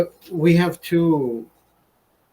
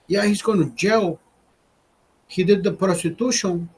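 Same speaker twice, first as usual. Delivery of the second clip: higher, mock Mexican accent with broken English; imitating Mexican accent; 4 semitones higher than usual. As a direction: mimic the coworker's thick Mexican accent. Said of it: "sounds like a Mexican when he talks".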